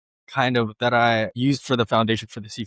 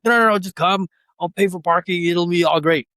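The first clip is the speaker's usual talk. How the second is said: higher, insistent pleading; pushy friends; 6 semitones higher than usual. Retold or imitated. Friends pressing him to the party, insistent. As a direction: mimic friends who won't take no. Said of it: "They're like".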